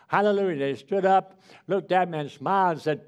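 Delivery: rejoicing revival preacher; preaching. Acted. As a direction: lift the congregation. Preaching climax.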